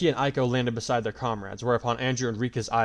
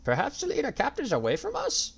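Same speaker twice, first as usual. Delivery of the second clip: put-on old man voice. A narrator's reading. Elder voice.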